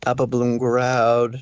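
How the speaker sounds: ordinary delivery, nothing marked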